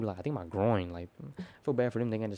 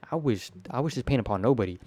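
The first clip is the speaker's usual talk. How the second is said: usual speaking voice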